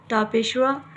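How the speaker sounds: normal talking voice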